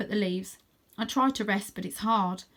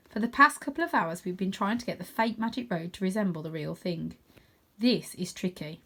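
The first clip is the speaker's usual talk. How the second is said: usual speaking voice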